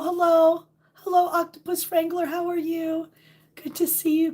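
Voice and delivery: ordinary talk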